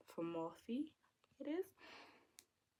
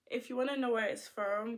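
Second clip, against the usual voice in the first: ordinary talk, nothing marked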